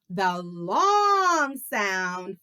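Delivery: slow, elongated "long"; drawn-out LONG. Shown as a demonstration. Teacher introduces the long vowel, drawn-out "long". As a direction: stretch "long" out, rising in pitch.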